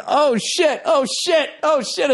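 re-live the shock of seeing the crash; alarmed cursing. Retold or imitated. Retelling his shock at watching the old guy fall, alarmed. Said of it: "So I'm going".

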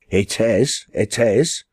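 ordinary delivery, nothing marked

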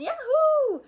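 very high, very loud whoop; joyful yell. Acted. Children yelling with joy at the easy test. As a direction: yell it out in triumph. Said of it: "we yelled".

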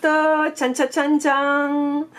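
fanfare imitée. Pretend. Dévoile les cartes, fanfare imitée.

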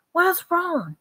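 high-pitched, frightened question; anxious, high, without a true whisper; terrified. A narrator's reading. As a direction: ask it scared, voice pitched high. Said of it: "she whispered in a terrified voice".

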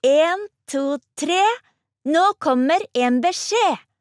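high, loud count-in, then slow proclamation; girl calling for everyone's attention; shouted announcement. A narrator's reading. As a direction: count in the crowd and call it out, drawn out. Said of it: "ropte Ann-Katrin".

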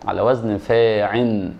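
slow "فاعل" drawn out as faa-'in; nunated ending. Shown as a demonstration. Teacher answers the class, slow nunated pattern ending. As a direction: stretch "فاعل", ending in -in without the l. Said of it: "واذا جه معتل الاخر".

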